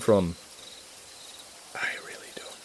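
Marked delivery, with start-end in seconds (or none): 1.7-2.6 whisper. Real, not acted. Whispered answer to chat question.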